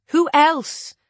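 high, loud, insistent repeat; rising voice. A narrator's reading. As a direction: ride the pitch up again. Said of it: "her voice rises like hot air".

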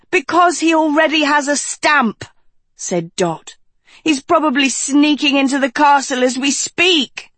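high-pitched, nearly crying; high fretful Dot. A narrator's reading. Dot frets, near tears.

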